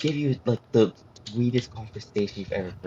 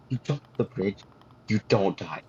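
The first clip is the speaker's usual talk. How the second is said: usual speaking voice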